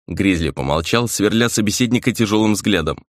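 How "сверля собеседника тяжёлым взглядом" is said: ordinary delivery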